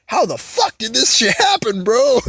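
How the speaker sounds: high, loud, slow drawn-out catchphrase with a strange put-on voice; signature catchphrase. Shown as a demonstration. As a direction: belt out your signature line in disbelief, drawn out. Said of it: "my fucking catchphrase".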